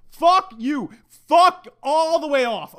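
high, loud, stretched-out swearing; indignant cursing. Real, not acted. Podcaster cursing his laughing fiancée, indignant. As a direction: tell her off, leaning on each word.

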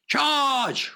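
high ringing battle roar. Acted. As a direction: roar the charge. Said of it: "roared Horatio".

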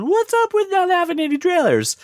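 high mocking voice of impatient fans; imitating fans complaining about no trailer; whiny fan. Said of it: "a couple of people are going".

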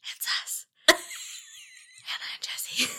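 whispered intro; ASMR whisper. Pretend. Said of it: "Using your A S M R voice".

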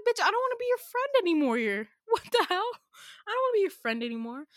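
high, loud, fast yell laced with laughter; mock shouted refusal. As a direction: scream the refusal at her, half laughing. Shouting at A in dream.

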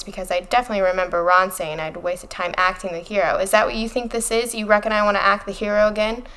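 normal talking voice

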